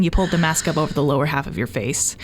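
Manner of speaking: usual speaking voice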